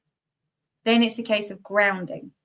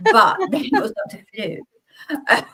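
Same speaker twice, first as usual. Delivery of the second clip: giggling through the line, slow and higher; higher, slow laughing retake; 5 semitones higher, 4 dB louder than usual. Real, not acted. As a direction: redo it while cracking up at the innuendo. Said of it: "Don't know where that came from".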